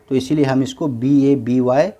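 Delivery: normal talking voice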